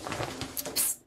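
a voiceless hiss to catch attention; a whispered psst from behind the curtain; secret psst. Acted. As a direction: signal them from hiding, unvoiced.

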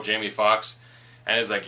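ordinary delivery

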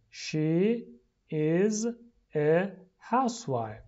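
"She ... is ... a housewife" with pauses between words; slow, separated model reading for repeating. Shown as a demonstration. Teacher drills the sentence for repeating, word by word.

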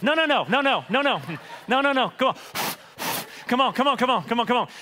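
hammed-up frantic refusal, high and loud; high, loud, rapid-fire comic protest; mock panic. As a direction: plead for a do-over in comic alarm. Acting man rejecting a grim verse.

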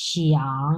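low, dipping শিয়াং that rises at the end; V-shaped third tone. Shown as a demonstration. Teacher shows the V shape, unhurried dipping third tone on শিয়াং. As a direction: take শিয়াং down low, then lift it. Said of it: "V shape উচ্চারণ করবেন".

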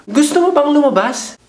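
squeaky high voice asking her out; high, thin pickup line to a girl; high-pitched voice. Shown as a demonstration. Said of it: "pababain mo yung tono ng boses mo".

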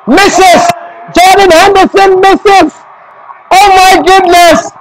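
high, stunned cry at a miss; high-pitched shocked match call; shocked commentary. Real, not acted. Live call of missed penalty.

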